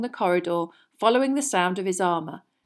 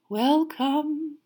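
soft misty voice; 8 semitones higher than usual. A narrator's reading. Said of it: "a soft, misty sort of voice".

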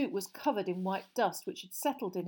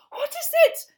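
very high, loud, frightened squeal; terrified gasp; 13 dB louder than usual. Acted. Aunt staring at the fireplace, terrified gasp. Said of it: "gasped Aunt Petunia".

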